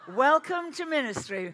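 high, loud mock preacher voice; booming pretend sermon voice; funny voice. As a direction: ham up a tiresome preacher. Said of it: "in an obtrusive and tiresome way with a funny voice".

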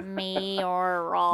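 put-on playful Minnesotan accent on "Mayoral". Host toying with the word mayoral, Minnesotan accent. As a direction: stretch "Mayoral" out in a mock Minnesotan way. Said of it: "doing it in a really Minnesotan way".

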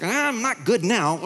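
slow, high, petulant; high and slow, pouting; sulky retort. Retold or imitated. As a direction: drag it out like a grumpy child. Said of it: "And I was like".

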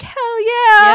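high, loud whoop of approval; gleeful cheer. Retold or imitated. Fan cheering on the bad guy, loud and exultant. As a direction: belt it out as a delighted fan cheer.

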